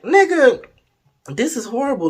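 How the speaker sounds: high, loud indignant retelling; mock outrage. Storyteller complains to his partner about bad head, mock-outraged. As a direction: act out an exasperated, high-pitched complaint.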